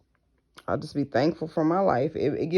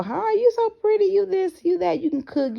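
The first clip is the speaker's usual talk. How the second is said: much higher, gushing imitation of flatterers; flattering fake voice. Mocking people who stroke her ego with high gushing praise. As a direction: gush fake compliments up high. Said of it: "They try to stroke your ego".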